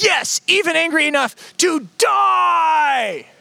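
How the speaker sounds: high, slow, stretched-out mock shout; Jonah's petulant shout. Jonah answering God, high drawn-out mock shout. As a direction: wail it like a sulking child. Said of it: "Jonah says".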